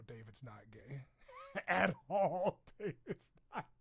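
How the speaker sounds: breathless laughing whisper; teasing laughing whisper; whispered giggle. Real, not acted. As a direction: giggle the denial under your breath. Teasing aside about David.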